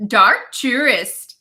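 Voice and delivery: slow, deliberate Kiwi accent; drawn-out amateur New Zealand accent. Shown as a demonstration. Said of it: "we just need the accent".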